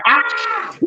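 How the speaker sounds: high-pitched imitated cry of alarm; cops' mock scream. Cops reacting to the headbutt, high mock scream. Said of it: "The cops are like".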